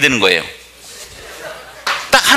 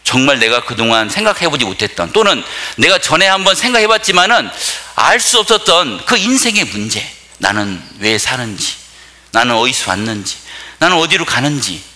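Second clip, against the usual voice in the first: usual speaking voice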